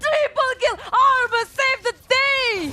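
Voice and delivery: excited. Real, not acted. Hype call of triple kill.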